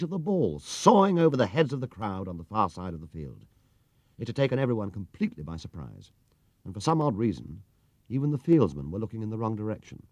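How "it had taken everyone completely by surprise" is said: ordinary delivery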